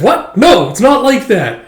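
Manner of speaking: high, loud protest; mock flustered denial. Character denying a romance to her, mock flustered. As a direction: deny it hurriedly with pitch leaping up.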